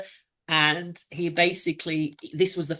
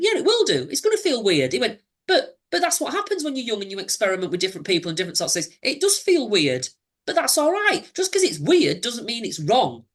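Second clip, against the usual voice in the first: put-on reassuring boyfriend; 7 semitones higher than usual. Abuser voiced, reassuring him.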